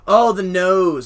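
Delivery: loud, slow sudden realization. Real, not acted. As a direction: shout it as it hits you. Suddenly remembers nose.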